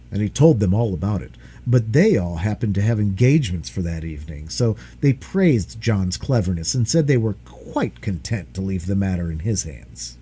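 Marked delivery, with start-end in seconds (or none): none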